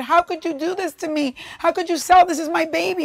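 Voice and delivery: retold high-pitched, wounded protest; indignant protest. Employee to boss who sold the station, indignant.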